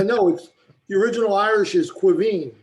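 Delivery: usual speaking voice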